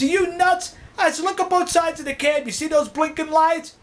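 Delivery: high-pitched, incredulous retort; retold alarm, pitched well up; acted exasperation. Cab driver challenges his passenger about the lights, exasperated.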